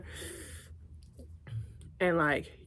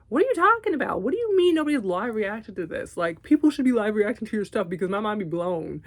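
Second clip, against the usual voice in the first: incredulous excitement; 4 semitones higher, 6 dB louder than usual. Retold or imitated. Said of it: "And I was like".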